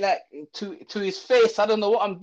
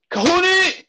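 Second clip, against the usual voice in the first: much higher, louder, slow call with a laugh; headmaster calling; 9 semitones higher, 7 dB louder, slower than usual. Retold or imitated. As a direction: sing out the name like a menacing headmaster. New headmaster calling him over.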